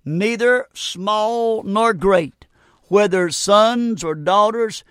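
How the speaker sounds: slow, solemn Bible reading; scripture reading. Real, not acted. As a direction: read the verse slowly and solemnly. Reads scripture aloud.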